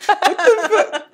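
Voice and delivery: shrieking with laughter; high laughing disbelief; laughing shout. Real, not acted. Burp reaction.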